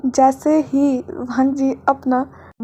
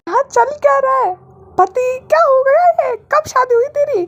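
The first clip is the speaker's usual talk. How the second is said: much higher, squealing outrage; shrill indignant friend; 11 semitones higher than usual. A narrator's reading. Friend outraged he wasn't told of the wedding, high squeal. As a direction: demand answers in a high squeal.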